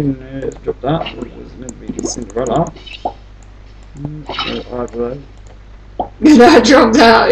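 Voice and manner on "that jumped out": higher, slow laughing surprise; a startled little laugh at it; surprised laugh. Real, not acted. Surprised by game event.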